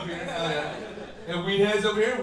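ordinary delivery

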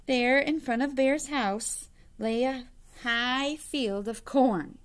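usual speaking voice

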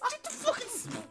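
high loud laughing yell; laughing shriek. Real, not acted.

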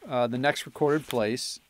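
normal talking voice

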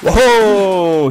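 loud startled whoa; startled shout. Real, not acted. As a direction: jolt out a big whoa. Sudden headshot.

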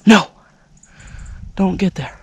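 sudden loud cry of alarm; sharp real outburst. Real, not acted. Hunter alarmed as the buck turns, loud cry. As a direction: blurt it out loud in panic. Deer heading wrong way.

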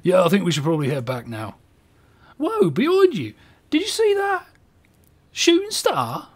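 high put-on British voice, excited; exclaiming in a hammed-up British accent. Winston points out a shooting star, British accent. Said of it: "the accent is just gonna change".

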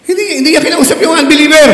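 high, loud and fast insistence; preaching shout. Real, not acted. Preacher correcting the congregation: fast, loud shout. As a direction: hammer the point home at full voice.

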